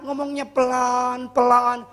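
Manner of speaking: slow, drawn-out demo of speaking slowly; slow talking. Shown as a demonstration. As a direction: show how slowly he spoke. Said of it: "Saya khotbah ngomong pelan-pelan". (memperagakan bicara pelan-pelan)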